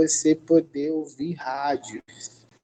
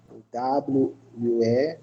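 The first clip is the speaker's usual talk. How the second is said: ordinary talk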